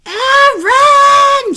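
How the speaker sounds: high, loud, long-drawn call; mother's yell. Acted. Mother calling her son, loud high yell. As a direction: holler the name across the house. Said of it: "she yelled".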